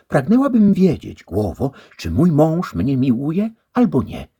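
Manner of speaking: bardzo wysoko i głośno, kobiecy głos; dama pyta. A narrator's reading. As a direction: niespokojna o męża. Second lady asks. (towarzyszka pyta o męża)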